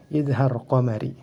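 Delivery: ordinary talk, nothing marked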